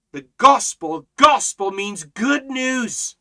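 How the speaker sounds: loud, rousing sermon cadence; fiery preaching. Acted. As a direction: lift the room with joy. Preaching at full force.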